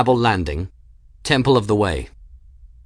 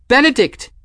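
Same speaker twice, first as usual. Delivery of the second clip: high, loud call through smoke; frightened cry; 8 dB louder than usual. A narrator's reading. Woman calling to her companion in the smoke, high cry. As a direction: cry his name out high and urgent. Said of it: "she cried".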